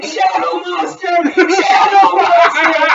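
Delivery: hammed-up high, loud scream; retold yelling. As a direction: imitate a panicked night-time yell.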